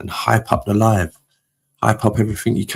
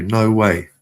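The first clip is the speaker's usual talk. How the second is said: ordinary delivery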